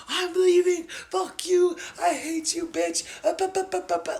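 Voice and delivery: loud, high, hysterical mock shouting; hammed-up high furious yelling; bugging out. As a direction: imitate her screaming in a fury. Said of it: "she just starts bugging out".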